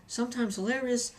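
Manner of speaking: normal talking voice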